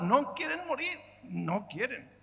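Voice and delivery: ordinary delivery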